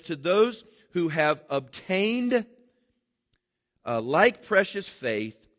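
drawn out, weighty reading of the verse; slow scripture reading. Acted. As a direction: read it slowly, savouring each word.

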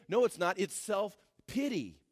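ordinary talk

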